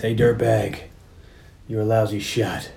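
low, soft gravelly tough-guy impression; Cobra, gravelly. Cop taunting gunman over the mic, gravelly. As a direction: growl it quietly, taking your time. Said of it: "He's so gravelly here".

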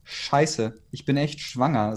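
ordinary delivery

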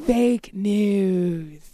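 drawn-out catchphrase in a light impression; lightly voiced politician, stretching it; Donald Trump. Shown as a demonstration. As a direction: linger on it like a mock president. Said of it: "Donald Trump would be like".

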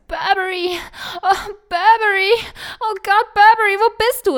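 high, loud dramatic call for a lost dog; calling out desperately. Pretend. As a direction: wail the dog's name along the beach. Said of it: "läufst am Strand lang und schreist". (verzweifeltes lautes Rufen)

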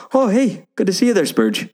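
high put-on Irish-ish accent; attempted New Zealand voice, sounds Irish. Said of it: "It's not Irish".

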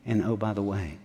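soft, heartfelt pastor voice; soft sermon close. Acted. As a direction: drop the voice and speak it gently to the congregation. Quiet emotional sermon close.

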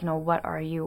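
usual speaking voice

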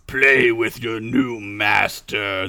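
slow, low commanding dark lord; cold rasping evil emperor. Acted. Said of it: "the Dark Lord commanded".

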